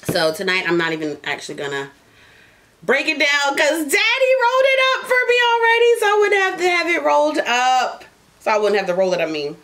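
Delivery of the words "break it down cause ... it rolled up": sudden high excited burst; bursting with glee about the rolled blunt. Real, not acted. Podcast host squealing to listeners about her pre-rolled blunt.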